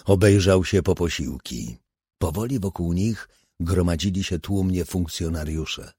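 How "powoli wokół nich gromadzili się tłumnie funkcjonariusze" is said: ordinary delivery, nothing marked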